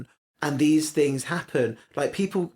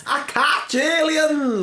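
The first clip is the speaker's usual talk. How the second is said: very high, slow mock cry; squeal-high imitation of a blurted confession; mimicked outburst; 13 semitones higher, 4 dB louder, much slower than usual. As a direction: echo the blurt, hammed-up and drawn out. Co-host echoes the blurted quote.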